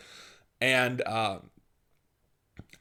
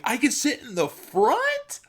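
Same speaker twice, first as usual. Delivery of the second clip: much higher, slow, amazed question; delighted surprise; 13 semitones higher, 4 dB louder than usual. Retold or imitated. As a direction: sound thrilled and amazed. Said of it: "I'm like".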